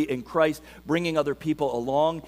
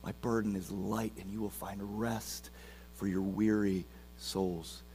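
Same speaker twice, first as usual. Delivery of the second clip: hushed scripture reading; 6 semitones lower, 10 dB quieter than usual. Acted. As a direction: lay the words down gently like an invitation. Soft scripture reading, Jesus quoted.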